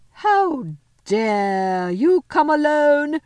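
slow, high, loud imperious witch; terrible voice. Acted. The witch confronts Edmund, slow terrible voice. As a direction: draw out each word in terrible wrath. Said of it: "said the witch in a terrible voice".

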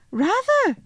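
put-on very high, delighted little-girl cry; eager assent. Lucy gladly letting the faun keep it, eager. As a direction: agree with a bright upward leap.

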